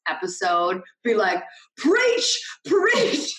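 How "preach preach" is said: high, sung-out "Preach, preach"; cheering preach. Retold or imitated. Cheering on her Southern preacher friend, high excited call. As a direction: egg her on like a church crowd, much higher. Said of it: "He is my Southern preacher girl".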